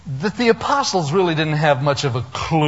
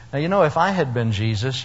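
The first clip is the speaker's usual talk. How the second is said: ordinary talk, nothing marked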